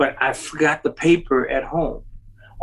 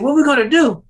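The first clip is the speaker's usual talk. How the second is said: high and louder, frantic question; mock panic; 11 semitones higher, 6 dB louder than usual. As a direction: cry it out like you're stranded.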